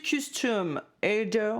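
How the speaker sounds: slow, drawn-out mock English accent; hammed-up English accent. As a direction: put on a showy English accent, slowly. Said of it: "avec mon super accent anglais".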